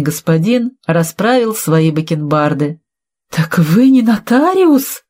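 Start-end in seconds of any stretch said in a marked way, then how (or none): 3.3-5.0 slow, high, stunned question; gasped shock. A narrator's reading. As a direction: ask it aghast, pitch leaping up. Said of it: "ахнул Виталий". (ошеломлённо ахнув)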